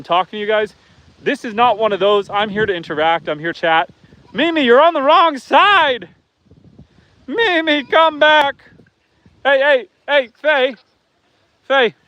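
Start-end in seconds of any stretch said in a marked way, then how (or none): 4.3-11.9 high, drawn-out call across the field; calling the goat. Real, not acted. Farmer calling a stray goat back, high and slow.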